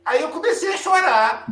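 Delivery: usual speaking voice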